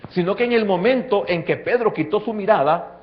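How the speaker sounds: loud, rising sermon climax; preaching at full force. Acted. Preacher building the sinking scene for the church, full force. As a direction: drive the story home to the congregation.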